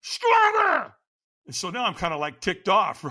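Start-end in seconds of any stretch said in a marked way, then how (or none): 0.0-0.9 very high, loud, strained scream; screamed demand. Retold or imitated. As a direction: imitate a trainer screaming for more. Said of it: "So he screamed at me".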